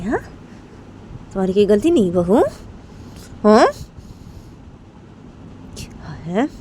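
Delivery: pointed questions with sharply rising ends; angry mother-in-law. Acted. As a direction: interrogate her, pausing after each jab. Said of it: "गुस्से से देख रही थी". (गुस्से में रुक-रुक कर सवाल)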